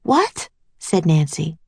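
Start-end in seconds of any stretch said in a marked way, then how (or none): 0.0-0.5 high, rising yelp of disbelief; astonished. A narrator's reading. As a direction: squeak it out in shock. Nancy astonished by claimed son.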